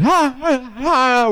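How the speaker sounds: high-pitched wordless mock yell; imitated yelling. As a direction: yelp out nonsense, imitating a yelling drunk. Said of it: "She's, like, yelling some kinda".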